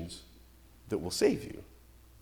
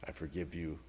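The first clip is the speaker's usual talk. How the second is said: much lower and softer, grudging mumbled apology; sulky kids' apology; 10 semitones lower, 10 dB quieter than usual. Retold or imitated. Bickering children forced to apologize to each other, grudging.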